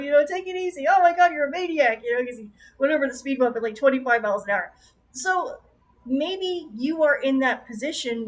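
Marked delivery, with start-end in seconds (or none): 0.3-2.0 raised, high mock yell; imitating an alarmed shout from a car; Keanu yelling. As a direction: holler in exasperation at a reckless driver. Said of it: "Keanu was yelling at people".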